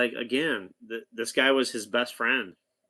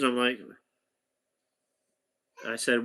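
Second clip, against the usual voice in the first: normal talking voice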